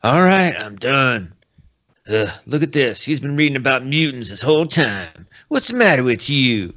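a lighter hint of the old-man grump; crotchety old man. Old man leaves, grumbling at Logan, light geezer voice. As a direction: huff off like a fussy geezer. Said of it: "why am I talking like that".

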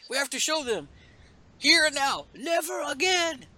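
high, put-on heroine protest; mock-dramatic defiance, pitched high; defiant character voice. Same defiant character carries on.

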